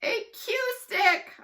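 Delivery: very high, slow, loud yell; loud, drawn-out yelled answer. Acted. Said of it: "I yelled".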